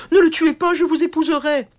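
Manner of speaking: supplie très haut et fort, au bord de la panique; supplique affolée. Acted. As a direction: implorer pour lui sauver la vie. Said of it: "cria la princesse". (supplication aiguë et forte)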